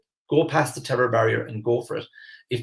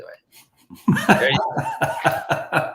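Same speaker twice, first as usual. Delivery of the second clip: laughing; 4 semitones higher, 4 dB louder, much slower than usual. Real, not acted. Joking about the screen looking better.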